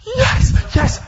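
YES screamed very high and loud; ecstatic yell. Retold or imitated. Bursting out of the car at the good news, an ecstatic scream. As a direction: let loose a jubilant, high-pitched YES. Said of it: "that wasn't good enough".

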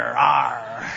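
low mock pirate "arr"; pirate growl. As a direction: play the fry-stealing pirate. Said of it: "well, the French fry pirate".

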